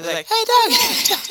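high, loud call to the dog. Retold or imitated. Owner yelling at the dog to drop the squirrel, high and loud. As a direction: yell after the dog to stop it. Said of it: "I was like".

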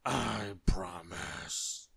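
very slow, groaning promise; moaning. A narrator's reading. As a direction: moan it out as if stuffed to bursting. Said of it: "moaned Mister Greedy".

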